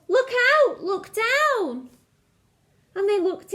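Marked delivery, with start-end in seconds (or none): 0.1-1.9 high, loud warning shout; yelled warning. A narrator's reading. Drip warning his sisters from the bank, loud high shout. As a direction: call out to warn them. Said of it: "who yelled".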